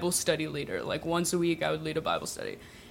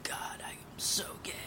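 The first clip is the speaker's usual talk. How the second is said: soft unvoiced whisper to herself; hushed lovestruck aside; whispered confession; 16 dB quieter than usual. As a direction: whisper it in awe. Inner thought whispered.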